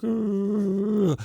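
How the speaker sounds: high, strained groan of panic; a squeezed, high-pitched groan of dread; inner scream. Retold or imitated. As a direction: let out a tight, high silent-scream ugh. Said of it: "And I'm screaming, not out loud, but to myself".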